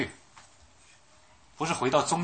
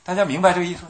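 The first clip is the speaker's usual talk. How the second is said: higher, louder question to the room; emphatic appeal; 6 semitones higher, 8 dB louder, faster than usual. Real, not acted. Preacher pressing the congregation, loud emphatic question. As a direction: demand they get it.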